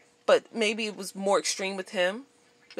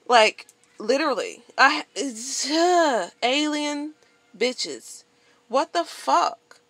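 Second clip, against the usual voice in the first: slow, higher incredulous outburst; disbelief; 5 semitones higher, 3 dB louder, slower than usual. Real, not acted. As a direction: stretch it out in disbelief. Disbelief at the story.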